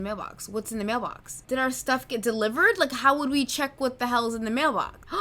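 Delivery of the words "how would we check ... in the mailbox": plain reading voice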